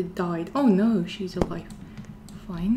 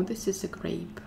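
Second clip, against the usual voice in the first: whisper; 4 semitones lower, 9 dB quieter than usual. Real, not acted. Sneaking past monster.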